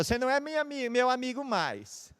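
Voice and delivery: high, mock childish whine; lightly voiced pouting child; child's sulky complaint. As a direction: imitate a sulking little girl. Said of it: "ela fala".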